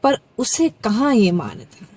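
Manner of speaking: measured, dramatic recital of a Hindi poem; poem recitation. Acted. Reciting a poem about Ram to viewers, dramatic recital. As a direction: let each line land, pausing between phrases.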